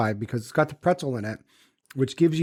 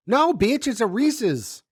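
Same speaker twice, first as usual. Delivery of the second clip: high, sassy correction of the name; outraged comeback in a higher voice; indignant correction; 9 semitones higher, slower than usual. Acted. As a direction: snap back much higher, scolding.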